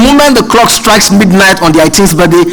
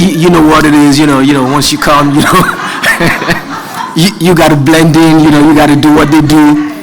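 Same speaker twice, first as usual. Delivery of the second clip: friend's put-on American accent, a bit lower, laughing through; lightly imitated American drawl; 4 semitones lower than usual. Friend explaining he must blend in, mild mock American accent. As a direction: mimic a Nigerian faking an American accent, lower. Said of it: "why are you talking like this?".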